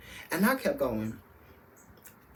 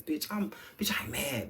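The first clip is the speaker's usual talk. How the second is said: whispered rant; 4 dB quieter than usual. Retold or imitated. Retold inner rant about AirPods.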